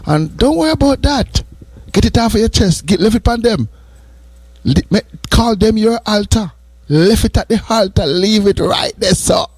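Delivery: high, lifted preacher's call to let go; preaching uplift. Acted. Preacher urging his listeners to lay burdens at the altar, in a high ringing call. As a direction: lift them up and tell them to leave it at the altar.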